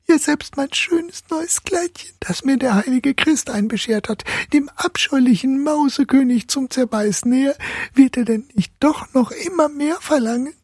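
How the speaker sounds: put-on high, tearful little girl voice; sobbing little girl. A narrator's reading. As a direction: plead through tears like a small girl. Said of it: "schluchzend also zum Nussknacker sprach".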